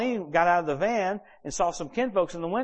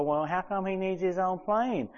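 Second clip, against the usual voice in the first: higher, drawn-out questioning complaint; mock a grumbling churchgoer, voice pitched up; carping church member; 4 semitones higher than usual. Church people objecting to pastor's plane, mock complaining.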